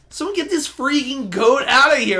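high, loud exasperated yell; Collector yelling. Retold or imitated. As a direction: shout it like a cornered villain. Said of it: "and he's like".

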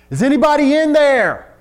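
shouted high over the roaring water; hollering rescuer. Acted. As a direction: call out into the flood to find anyone.

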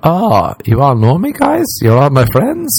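high-pitched, touched disbelief; delighted surprise. Retold or imitated. Man mistaking a surprise party for his own, delighted surprise. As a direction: play pleasantly astonished, voice lifting into questions.

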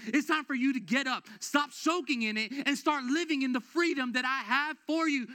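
loud, high declaration as God; preaching at full force. Acted. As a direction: proclaim God's word to the church with power. Voicing God's charge.

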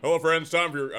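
brisk, bright radio host greeting; upbeat announcer. Acted. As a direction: open the segment like a sports host. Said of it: "I'm like Jim Nantz".

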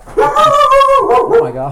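very high, slow, loud squeal; drawn-out shocked squeal. Acted. Reacting in mock shock.

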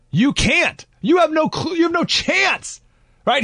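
excited high disbelief. Real, not acted. Mocking the interviewers' hopeless attempts.

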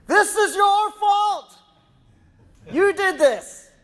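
high, loud mock blame, drawn out; accusing shout. Pregnant wife blames husband, mock accusing shout. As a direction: yell it slowly as a hammed-up accusation. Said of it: "she looks at me and says".

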